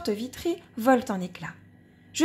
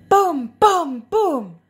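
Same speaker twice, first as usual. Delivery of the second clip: booming drum sound; 5 semitones higher, 8 dB louder, much slower than usual. Acted. Bass drum booms.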